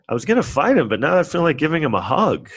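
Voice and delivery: hammed-up softie; softened rival. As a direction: melt from fight to hug. Opponent softening.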